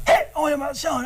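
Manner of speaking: high, strained [name] shout; [name] impression. Telling the band story, he slips into a high [name] cry. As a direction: mimic [name] calling out to his band leader. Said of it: "and only [name]".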